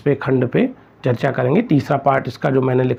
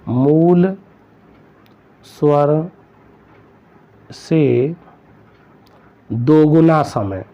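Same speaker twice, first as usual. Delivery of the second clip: slow dictation; much slower than usual. Shown as a demonstration. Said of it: "हो सकता है यही पूछ लें".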